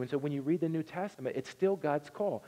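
ordinary delivery

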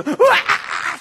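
high, loud hammed-up bawl; mock child wail. Granddaughter taken downstairs, still bawling in imitation. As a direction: burst into an exaggerated childish howl. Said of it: "she's still crying".

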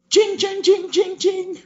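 very high sung jing noises; sword clang sounds. Retold or imitated. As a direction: ring out like clashing swords.

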